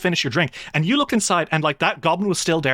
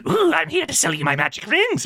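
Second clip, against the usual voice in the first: high, sly goblin sales pitch; high sneaky goblin hawking rings; 10 semitones higher than usual. Acted. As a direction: play a sneaky little goblin peddling his wares. Storyteller voices the sly goblin.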